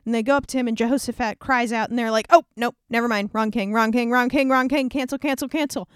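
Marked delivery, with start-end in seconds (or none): 2.3-5.9 panicked backpedal. Acted. Syrian soldiers realize they chased the wrong king, loud and frantic. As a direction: call off the attack in a hurry.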